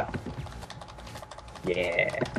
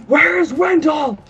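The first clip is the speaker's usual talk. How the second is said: shrieking high, slow and drawn out; high frantic shout; slower than usual. Acted. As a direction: yell for him in a panic. Yelling for friend in game.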